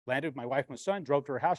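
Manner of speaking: ordinary talk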